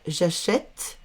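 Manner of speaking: "achète" said as a-shett, with open è; open è sound. Shown as a demonstration. Teacher gives pupils the correct form, "achète" with open è. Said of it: "on va ouvrir".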